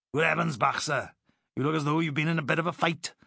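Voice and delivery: cheeky Welshman. Acted. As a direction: play Jenkins as a chirpy Welsh comrade. Jenkins voiced, Welsh.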